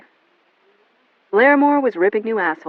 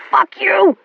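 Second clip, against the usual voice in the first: much higher, loud scream; 13 semitones higher, 9 dB louder than usual. A narrator's reading. William screaming at Melanie as she fights. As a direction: scream it in panic. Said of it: "he heard himself screaming".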